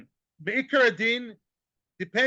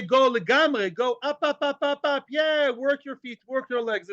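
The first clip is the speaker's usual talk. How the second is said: higher, sing-song urging; cheering him upstairs; 6 semitones higher than usual. Retold or imitated. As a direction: egg him on up the stairs, lightly mocking. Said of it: "Do we say".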